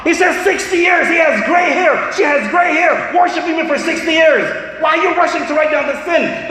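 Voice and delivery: high, pressing voice voicing Allah's plea; urgent command. Allah stopping the angel from writing the sin, urgent. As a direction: hold the angel back, insistent and protective. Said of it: "Allah says".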